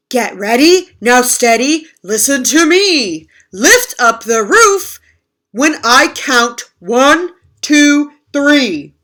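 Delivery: loud, slow barked orders; bossy commands. Acted. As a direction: command the crew loudly. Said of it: "Moose said".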